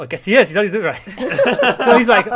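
usual speaking voice

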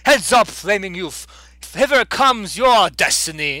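put-on loud, grand old prophet proclaiming; old hobo prophet. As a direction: boom it out like a crazed oracle. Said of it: "he sees the [name] members saying".

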